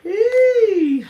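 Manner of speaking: high, loud drawn-out hey; excited call. Real, not acted. Streamer greeting live viewers, excited call. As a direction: sing out a long, high greeting.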